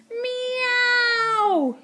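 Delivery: long, high wailing meow; hammed-up cat yowl; panicking cats. A narrator's reading. Cats leap about the slimy sea, yowling.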